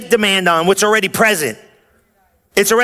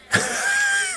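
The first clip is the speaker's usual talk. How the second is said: high burst of laughter; 8 dB quieter than usual. Real, not acted. Loud laugh after point.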